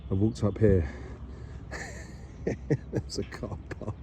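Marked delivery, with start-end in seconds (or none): 0.9-2.8 soft unvoiced laugh, breathy; whispered giggle. Real, not acted. Vlogger realising the climb was pointless, quiet giggle. 2.9-3.9 breathy giggle around the words, barely voiced; laughing whisper. Real, not acted. Vlogger laughing at a car park after climbing, whispered through laughter.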